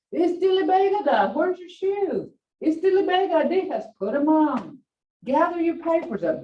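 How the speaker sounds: put-on high, insistent commands to the boy; nagging grandmother. Grandma ordering grandson to pack.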